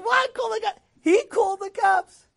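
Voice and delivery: hammed-up disbelief at cops; shocked disbelief. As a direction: act out being floored by the news. Acting out shocked reaction.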